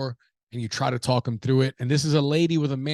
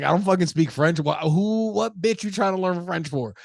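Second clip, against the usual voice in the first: higher-pitched imitation of an indignant girlfriend; mock her outraged disbelief, pitched up; retold incredulous scolding; 6 semitones higher than usual. Retold girlfriend mocking his French.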